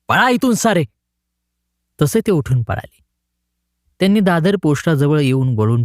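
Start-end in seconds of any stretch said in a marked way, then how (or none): 0.1-0.8 shouting at them. A narrator's reading. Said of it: "ओरडला".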